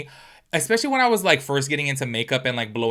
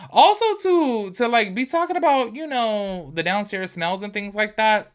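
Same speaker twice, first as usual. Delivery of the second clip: high, slowed, scandalized; disgusted; 7 semitones higher, slower than usual. Real, not acted. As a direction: let the disgust pull it up high. Disgusted at his crude talk.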